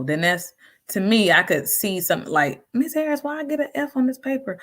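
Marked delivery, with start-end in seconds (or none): 2.7-4.6 high pleading student voice, much higher; whiny student. Retold or imitated. Student complaining to Miss Harris about an F, high whiny voice. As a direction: play the complaining pupil.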